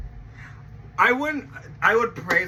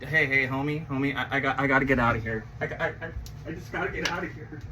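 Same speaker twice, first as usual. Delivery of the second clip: hurried exit; 6 semitones lower, faster than usual. Retold or imitated. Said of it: "they're like".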